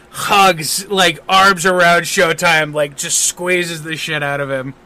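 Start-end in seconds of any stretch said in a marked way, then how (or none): none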